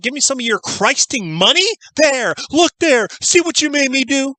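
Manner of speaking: ranting Annie quote. Retold or imitated. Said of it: "When you can quote lines from 'em".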